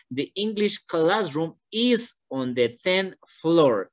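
slow, careful teacher reading; slow model reading. Shown as a demonstration. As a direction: model it slowly for the student. (lectura lenta de ejemplo)

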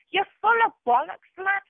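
high, furious yell; high sneering yell of contempt. Real, not acted. Angry rant at a man.

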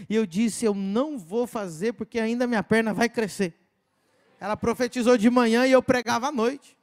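loud, driving sermon delivery; preaching at full force. Acted. Preacher telling the church the miracle, loud preaching. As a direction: proclaim it to the congregation.

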